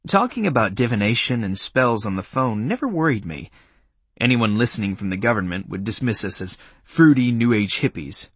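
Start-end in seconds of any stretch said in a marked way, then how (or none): none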